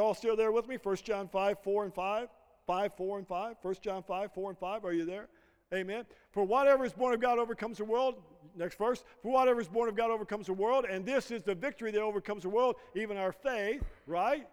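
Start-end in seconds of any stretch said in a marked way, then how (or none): none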